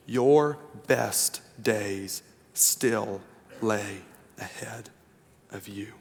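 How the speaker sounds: very slow, drawn-out restatement, each word weighted. Shown as a demonstration. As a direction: lean into every word the second time. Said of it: "Your best days still lie ahead of you".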